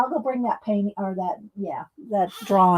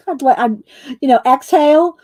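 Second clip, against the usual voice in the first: laughing; 5 semitones higher, 9 dB louder than usual. Real, not acted. Laughing while telling story.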